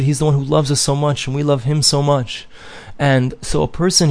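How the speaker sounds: ordinary talk, nothing marked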